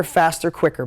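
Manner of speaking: normal talking voice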